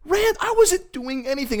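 amazed. Real, not acted. Amazed at the random word.

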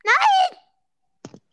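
panicked shout. Real, not acted. Panicked shout at teammate's plan.

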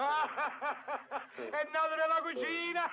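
slow, laughing repeat of the news; laughing disbelief. Acted. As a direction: savour it through laughter. Ride incredulo ripetendo. (ripetuto ridendo, incredulo)

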